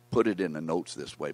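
normal talking voice

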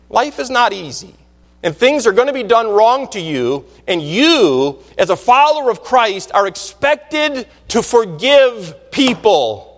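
slow right down to land the final words; preacher solemnly insisting on a duty; emphatic preaching. Real, not acted. Preaches a charge to congregation.

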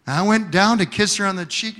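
usual speaking voice

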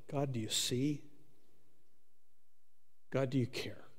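hushed plea to God, dropping to a whisper; whispered prayer. Retold or imitated. Believer privately asking God if he cares, in a whisper. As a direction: whisper the doubt you never say aloud. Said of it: "questions that all of us ask".

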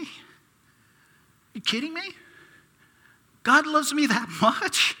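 can't believe he'd love me that much; stunned disbelief. Pretend. Believer hearing God's love, stunned disbelief.

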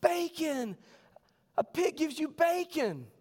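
chorus it like schoolkids; kids answering. Retold or imitated. Kids answering the teacher, high slow chant. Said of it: "And they said".

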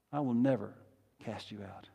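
low, hushed promise fading away; gentle reply, dropping to near-whisper; Jesus' reassurance. Acted. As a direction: answer softly, pausing before the last words. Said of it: "The response of Jesus".

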